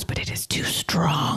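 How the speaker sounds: whispered, slow and grave; hushed warning. Retold or imitated. Guru warning her the mantra is too strong, whispered. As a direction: breathe it like a secret danger.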